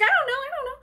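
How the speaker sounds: high-pitched helpless fluster; mock panic. [name] answers frantic Jamie, mock panic. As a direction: squeal it in hammed-up panic.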